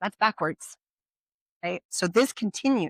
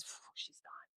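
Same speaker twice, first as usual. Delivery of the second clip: relieved whisper; 26 dB quieter than usual. Retold or imitated. Husband relieved wife left.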